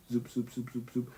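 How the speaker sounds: soft quick zoop zoop sounds; zapping noises. Retold or imitated. Explaining the brain to viewers, soft zap noises. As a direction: imitate little neuron zaps. Imitating neuron zaps.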